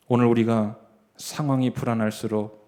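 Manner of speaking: normal talking voice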